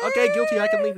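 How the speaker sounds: very high, loud fake wail; co-host playing a convicted man, fake crying; mock sob.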